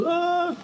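a sudden high yelp, imitating the audience; high mock scream. Vlogger imitating a startled audience, short high scream. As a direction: squeal it out like a startled viewer.

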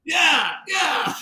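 high, loud, gleeful yeah; excited confirmation. Real, not acted. Teller confirms the twist to listener, real excited shout. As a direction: shout it back, voice shooting up.

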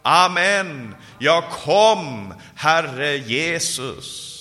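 preacher's impassioned retold cry to Jesus; fervent imploring call. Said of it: "så ropar han".

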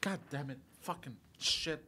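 blocked nose, muttered curses; cursing through a stuffy nose; snotty crying. Real, not acted. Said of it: "I gotta go blow my nose".

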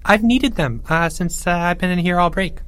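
high, chirpy little voice; cheerful voice. A narrator's reading. Geoffrey from further down the hole, cheerful voice. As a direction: pipe up cheerfully. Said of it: "came a cheerful voice".

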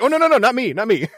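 high, loud flustered refusal; backing away nervously; shy protest. Retold or imitated. Shy Leroy refusing to learn moves.

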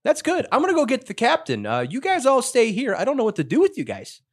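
high-pitched put-on crewman voice; flustered crewman. Crewman speaks to the strangers.

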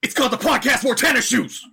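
high mock battle yell. Superhero fighting the bad guys, yelled. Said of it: "You peaked the hell out of your mic".

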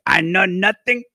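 high, slow German guard voice; doing Sergeant Schultz, slow and high. Retold or imitated. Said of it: "Sergeant Schultz would be like".

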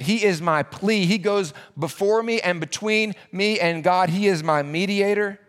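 usual speaking voice

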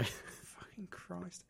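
slow, breathy whispered curse; exasperated whisper. Real, not acted. The podcaster gives up on the friends' banter, whispering. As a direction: hiss it under your breath, worn out.

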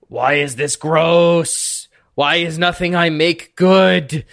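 drawn-out, frustrated cook's wail; acted exasperation, slow and swelling; really frustrated. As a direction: lament your own cooking, stretching the words. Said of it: "really frustrated".

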